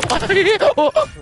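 squeaky high hiccup laugh; hiccup giggles. Real, not acted. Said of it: "like a fucking nineteen forty cartoon drunk".

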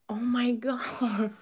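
awed whisper. Real, not acted. Calming down after the pull, real awed whisper. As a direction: hush it out, barely voiced, amazed. Still stunned by the card.

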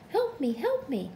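far-off little cry for help; faint distant cry. A narrator's reading. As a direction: call weakly from far away. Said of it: "a faint voice calling".

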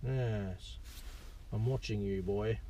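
low, soft playful warning; a lightly hammed-up "I've got my eye on you"; mock menace. Toddler kicking the seat.

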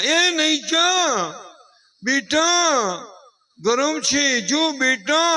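high, loud, slow warning call; alarmed mother. Retold or imitated. Mother warning her child away from the lamp, high, loud alarm. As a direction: call out to stop the child in panic. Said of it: "તા તો એની માં".

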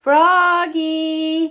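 called high across the yard; a mother calling her child from the door; long high call. A narrator's reading. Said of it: "called his mother".

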